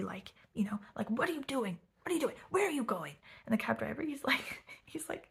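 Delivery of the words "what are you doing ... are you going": higher-pitched imitation of angry demands. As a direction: fire off indignant questions, mock-angry. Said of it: "like, all angry".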